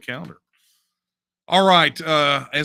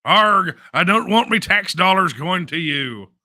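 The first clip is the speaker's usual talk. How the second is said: loud growling pirate, higher; 6 semitones higher, 8 dB louder than usual. Retold or imitated. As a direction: Arg it out like a pirate. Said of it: "Now you're talking like a pirate".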